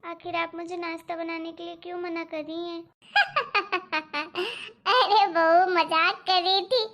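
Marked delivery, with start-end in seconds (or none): 0.0-2.8 frightened bride. Acted. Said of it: "मैं तो डर ही गई थी". 3.1-4.6 teasing laugh. Acted. Mother-in-law reveals joke.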